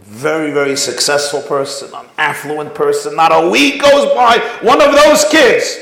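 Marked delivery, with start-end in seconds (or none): 3.1-5.8 preaching. Real, not acted. Rabbi telling parents his story, preaching loud. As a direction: drive it home to the crowd.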